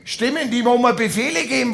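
usual speaking voice

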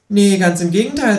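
high, cheeky kangaroo voice. Acted. Kangaroo asks narrator to shove over, high cheeky. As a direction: pitch way up for the cheeky marsupial.

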